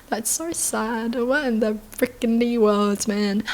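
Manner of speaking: soft, slow, saddened voice; sad and quiet. Real, not acted. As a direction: let it sink in, quietly and slowly. Reacting to tragic story.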